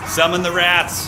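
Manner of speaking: high, loud, slow villain summoning; dramatic summons. Pretend. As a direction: call the rats forth like a warlord.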